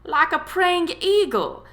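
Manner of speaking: high, loud, slowed-down tease; teasing punchline. A narrator's reading. Tom mocks Daphne's scream, teasing loudly. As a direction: deliver the comparison with rising glee.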